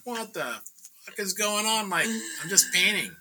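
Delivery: high-pitched incredulous exclamation; bewildered protest. Retold or imitated. Painter reacts to sudden police sirens, bewildered protest. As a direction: pitch it up in disbelief.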